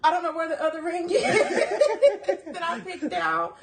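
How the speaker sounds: high, laughing gush that softens; giddy gushing. Retold or imitated. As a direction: gush through laughter about the ring. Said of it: "And I was like".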